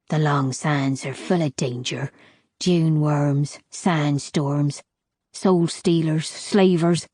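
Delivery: put-on a weathered old woman listing the desert's dangers; old crone.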